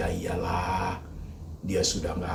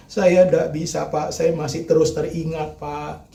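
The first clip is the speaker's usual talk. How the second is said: raised, whiny mock complaint; mimicking a complainer; 7 semitones higher, 5 dB louder than usual. Preacher mocking a congregant who keeps complaining. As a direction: imitate the grieving congregant pleading. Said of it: "Asal ngomongnya".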